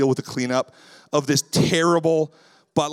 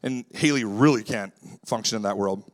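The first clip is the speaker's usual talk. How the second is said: normal talking voice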